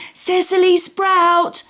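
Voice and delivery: high, slow calling shout. Acted. Campers shouting the carrot's name, calling out. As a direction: call it at the top of your voice. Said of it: "shouting out".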